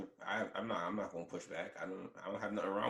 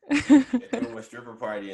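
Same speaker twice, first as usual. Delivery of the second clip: laughing; 12 semitones higher, 10 dB louder than usual. Real, not acted. Laughing while retelling news.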